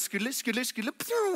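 mouth-made skidding feet, then a high zip; sound-effect it like a cartoon dash; cartoon run sounds. Joshua dashing in like a cartoon, mouthed skid noises. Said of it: "he, like, cartoon runs".

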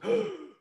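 sharp high gasp of shock; mock gasp. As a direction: gasp as the other actor's face.

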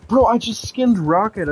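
high, loud horrified realisation; loud, stunned "I just skinned Rocket"; shocked realisation. Real, not acted. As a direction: blurt it out as it hits you. Realising he skinned his pet.

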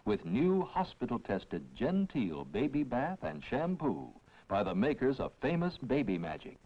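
ordinary talk, nothing marked

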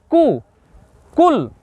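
কু, কুল said with a front k; light kaf. Shown as a demonstration. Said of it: "জিহ্বার গোড়াটা থেকে সামনে এগিয়ে এসে". (কুল সামনের কাফ দিয়ে হালকা উচ্চারণ)

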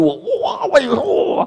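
high, cracked cry of a hurt spouse; mock wounded spouse. Preacher imitating a hurt spouse crying out. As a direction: wail it like you've been stung. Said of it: "I say this one, you".

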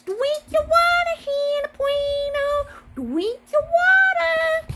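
high, loud bossy order; Missus O voice. Retold or imitated. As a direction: boss them like Missus O. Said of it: "Missus O said".

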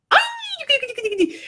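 very high squeak with a laugh; silly high squeal. Retold or imitated. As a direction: squeal playfully to call him back. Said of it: "y otra vez". (chillido agudo entre risas para llamar al perro)

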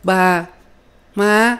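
put-on very high baby voice; babbling toddler. A narrator's reading. Little boy babbling for his parents, high and childlike. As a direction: chirp single words like a toddler. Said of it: "miệng nó bi bô".

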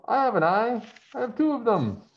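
high, loud, eager call; excited yell. Acted. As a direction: blurt it out like a proud kid. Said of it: "Morris yelled".

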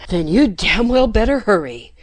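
loud, urgent, breathless demand; panted urgency. A narrator's reading. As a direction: push it out between gasps. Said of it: "Jeff panted".

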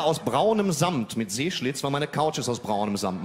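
ordinary talk

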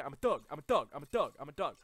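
very loud, punchy repeated boast; blustering boast. Pretend. Gamer insisting he's a thug, loud frantic bluster. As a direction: bark it out in short bursts. Puffing himself up while attacked.

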